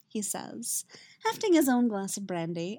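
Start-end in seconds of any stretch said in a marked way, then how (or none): none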